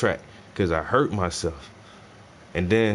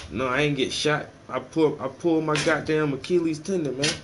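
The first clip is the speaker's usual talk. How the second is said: ordinary talk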